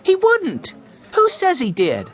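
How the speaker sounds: very high, indignant outburst; defensive shout. A narrator's reading. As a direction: leap loudly to a friend's defence. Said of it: "shouted Benny".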